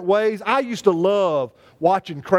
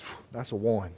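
much lower and much softer, sighing; weary sigh; 13 semitones lower, 11 dB quieter than usual. Shown as a demonstration. The pastor advising a young man on dating: sighing low verdict. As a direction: breathe out and rate it low and soft. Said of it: "it's just like".

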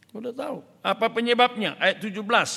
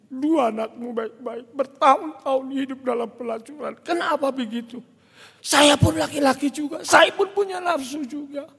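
raised pitch, building to loud rebuke; acted scolding, getting louder; indignant confrontation; 7 semitones higher, 5 dB louder than usual. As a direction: confront him with mounting outrage. Pastor confronts unfaithful husband, retold.

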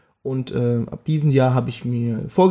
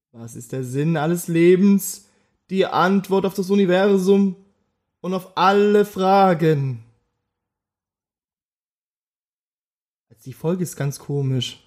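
solemn oracle voice; 4 semitones higher than usual. Acted. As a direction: intone it like a mystic revealing truth. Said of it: "Marco aus der Folge sechzehn".

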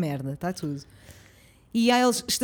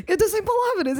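higher, stunned, laughing at it; mock speechless through a laugh; retold shock; 7 semitones higher than usual. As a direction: speechless disbelief. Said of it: "eu estava em choque".

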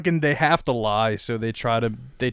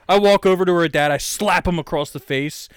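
ordinary talk, nothing marked

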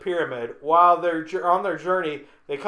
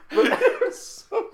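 cracking up; 3 dB louder than usual. Real, not acted. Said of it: "laughing at my own joke".

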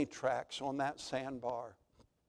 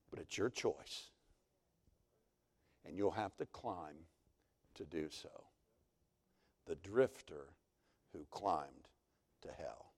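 much softer and lower, sinking to a whisper at the end; dramatic hush; 8 semitones lower, 10 dB quieter than usual. Real, not acted. Preacher warning the congregation of their choice, in a sinking hush. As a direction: drop it to a grave hush.

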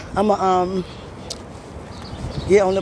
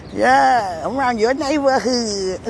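ordinary delivery

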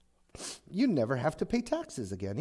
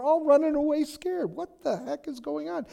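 appalled; 12 semitones higher than usual. Acted. David appalled at fleeing army.

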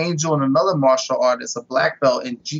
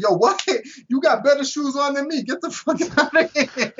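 laughing friend; 10 semitones higher than usual. Retold or imitated. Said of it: "my boy's like".

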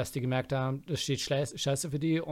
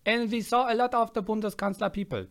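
put-on Ossi-accented English; 7 semitones higher, 5 dB louder than usual. Tourist recounting a Berlin trip, Ossi accent in English. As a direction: draw out English with an East German twang. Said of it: "Kannst du Englisch so reden mit dem Akzent".

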